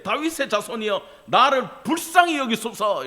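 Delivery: high, insistent plea. Acted. Beggar calling to Jesus despite being hushed, crying out harder. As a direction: push the cry out, rising in force. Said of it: "그가 더욱 크게 소리 질러 이르되".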